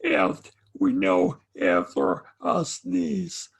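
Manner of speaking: ordinary talk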